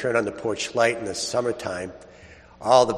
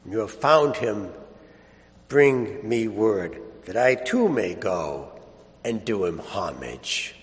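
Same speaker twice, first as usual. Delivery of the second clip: solemn Gospel reading. Acted. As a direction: read scripture with hushed reverence. Solemn Gospel reading, held style.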